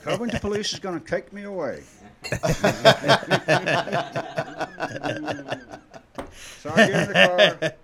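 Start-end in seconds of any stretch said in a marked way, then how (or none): 0.0-1.8 laughing. Real, not acted. Laughing through the joke. 2.0-6.6 hearty laugh. Real, not acted. Long laugh after punchline.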